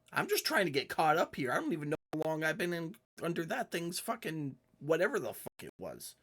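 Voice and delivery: high-pitched rushed babble; squeaky NPC voice. Acted. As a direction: rattle it off in the high little voice. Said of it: "He's like".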